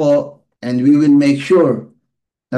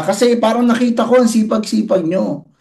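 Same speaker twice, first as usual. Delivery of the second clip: usual speaking voice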